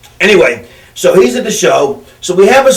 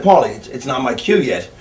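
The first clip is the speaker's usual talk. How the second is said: calm, unbothered reply; 6 semitones lower, 7 dB quieter than usual. Retold or imitated. Calm doctor under pressure.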